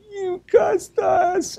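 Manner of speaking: put-on very high, slow, squealed child outcry; shocked son. Father imitating his son, shocked that dad swore, squealing high. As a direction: squeal it like a stunned kid. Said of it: "And then Michael was like".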